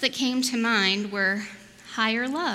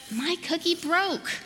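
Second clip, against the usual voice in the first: high, slow storybook lament; mild childlike dismay over a broken treat; child's dismay; 7 semitones higher, slower than usual. A narrator's reading. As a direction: draw out the little tragedy, pitched up. Storybook child's small disaster.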